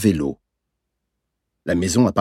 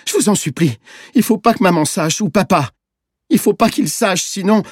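put-on very high, desperate child begging; sobbing plea; 11 semitones higher, 4 dB louder than usual. A narrator's reading. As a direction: beg in tears, voice pitched right up. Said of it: "il a sangloté". (supplication aiguë d'enfant en larmes)

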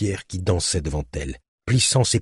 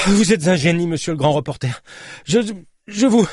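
high, elated, faltering at the end; overjoyed gratitude; 10 semitones higher than usual. Acted. Rescued cyclist in the journalist's arms, overjoyed stammering thanks. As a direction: gush thanks until words fail you.

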